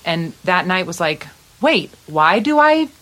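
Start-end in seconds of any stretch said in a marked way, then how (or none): 1.6-2.9 higher, louder, stretched-out words; slow, emphatic wonder as it dawns on you; excited realization. Retold or imitated. Musician recalling a revelation that night, excited realization.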